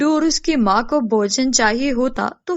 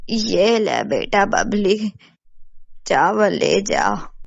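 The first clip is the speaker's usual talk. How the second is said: put-on very low, slow older-woman voice; kindly village mother; 4 semitones lower, slower than usual. Mother gives Babli rice, low slow motherly voice. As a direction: hand it over slowly, pitched well down.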